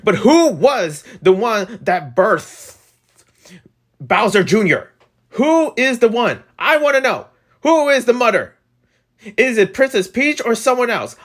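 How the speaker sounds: high, drawn-out questioning; mock-dramatic demand. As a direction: demand the answer with hammed-up urgency. Mock-dramatic demand to know the mother.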